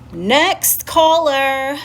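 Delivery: loud, high, drawn-out announcer call; mock call-in host. Host moving on after blocking a troll, mock announcing. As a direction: sing it out like a host dismissing someone.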